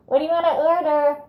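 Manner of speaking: high, loud, tinny speaker voice; muffled drive-through speaker. Retold or imitated. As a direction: squawk like a fast food intercom. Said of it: "Like a muffled fast food drive-through speaker".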